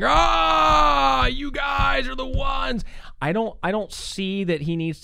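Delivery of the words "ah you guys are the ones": high, loud, veins-out shouted accusation; pounding shout. Shown as a demonstration. Preacher mocking an angry Peter: furious shouted accusation. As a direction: hammer it at them like a ranting preacher, slow. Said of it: "gotta be pounding ... veins coming out of his neck".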